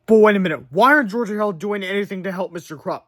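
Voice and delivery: ordinary delivery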